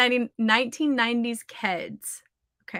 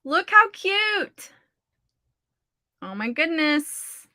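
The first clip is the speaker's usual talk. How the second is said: excited; 7 semitones higher, slower than usual. Real, not acted. Showing off new shoes.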